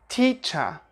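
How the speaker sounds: "teacher" ending in "uh", no R; British non-rhotic "teacher"; schwa ending. Shown as a demonstration. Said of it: "replace that with the schwa sound".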